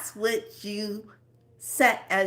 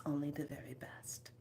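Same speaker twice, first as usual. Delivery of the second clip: whisper; 5 semitones lower, 16 dB quieter than usual. Shown as a demonstration. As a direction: drop to a soft whisper. Imagining herself on a throne.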